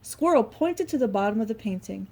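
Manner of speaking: ordinary delivery, nothing marked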